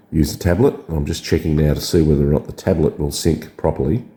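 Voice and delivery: ordinary talk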